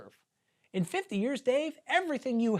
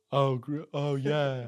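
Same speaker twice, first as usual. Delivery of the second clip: Sam Elliott impression; slower than usual. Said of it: "Do Sam Elliott".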